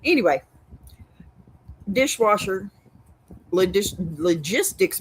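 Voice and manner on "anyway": ordinary talk, nothing marked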